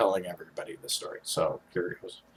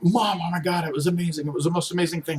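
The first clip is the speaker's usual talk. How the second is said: put-on higher, eager young self; excited kid gushing; 7 semitones higher, faster than usual. Teen gushing to Mom about the jackalope, higher and eager. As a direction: gush it like a proud kid. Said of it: "I'm explaining it to her".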